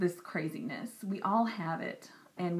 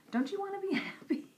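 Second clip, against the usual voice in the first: laughing plea; 12 semitones higher, much faster than usual. Real, not acted.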